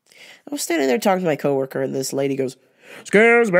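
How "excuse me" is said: loud, demanding customer call; imitating the pushy shopper demanding service, loud; entitled customer. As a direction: snap for attention like you're owed it. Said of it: "And she's yelling".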